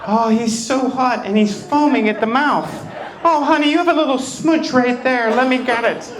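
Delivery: high, put-on doting mother; a hammed-up fussy mom; fussing mom. Mom fussing over her son's face, in a high mock voice.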